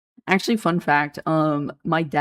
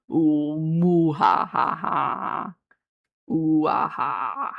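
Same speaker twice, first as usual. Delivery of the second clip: very slow, drawn-out villain laugh; hammed-up evil cackle, stretched out; much slower than usual. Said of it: "Give us your most evil voice".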